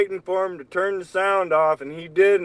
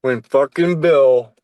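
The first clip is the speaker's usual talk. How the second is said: normal talking voice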